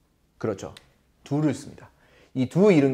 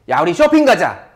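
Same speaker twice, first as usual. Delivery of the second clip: eager invite; 9 semitones higher, 7 dB louder than usual. Pretend. Teacher voices a friend's invite.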